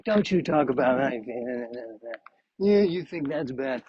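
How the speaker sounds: put-on high, strained old-timer voice; old person. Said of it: "somebody who's seventy is like".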